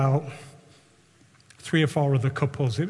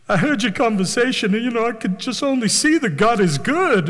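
higher-pitched, badly faked American voice; bad American accent; 6 semitones higher, 4 dB louder than usual. Biker praising God to diners, bad American accent. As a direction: play an American stranger with a clumsy accent. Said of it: "I don't know what kind of America that is".

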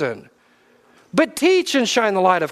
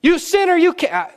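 mock scold; 9 semitones higher, slower than usual. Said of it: "the one that goes".

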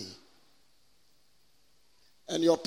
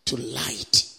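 scripture proclamation; 5 semitones higher, 4 dB louder than usual. Acted. Preacher reads scripture to the congregation, solemn proclamation. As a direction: declaim it phrase by phrase, pausing between.

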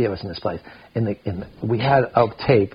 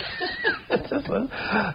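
high laugh; 8 dB quieter than usual. Real, not acted. Laugh after punchline.

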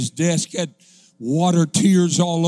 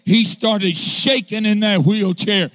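louder, rising preacher's chant, building to a shout; full-force preaching; 3 semitones higher, 6 dB louder than usual. Acted. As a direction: whoop the story home to the congregation. Preaching climax of the sermon.

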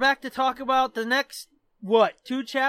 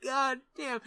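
usual speaking voice

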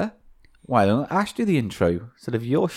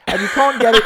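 ordinary delivery

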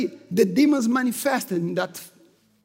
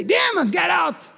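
mock command; 6 semitones higher than usual. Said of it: "I tried to say".

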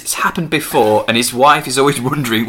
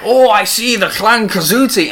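high, hammed-up mock Scottish; terrible Scottish accent; 9 semitones higher, 4 dB louder than usual. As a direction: do a badly faked Scottish accent. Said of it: "it's a terrible Scottish accent".

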